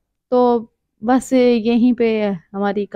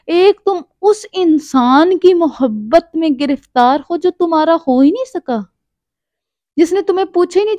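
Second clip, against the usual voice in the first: heated scolding; 5 semitones higher, 3 dB louder than usual. Retold or imitated. Scolding friend who refuses marriage, raised pitch and loud. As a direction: scold her hard, pleading she listen.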